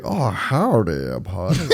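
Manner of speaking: slow, drawn-out Southern cowboy drawl; playful mock Southern accent. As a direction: greet him like a hammed-up Texas cowboy.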